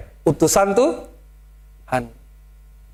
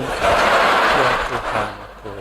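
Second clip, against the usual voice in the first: laughing; 8 semitones lower, much slower than usual. Real, not acted. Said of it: "udah letoy sejak di Bandung".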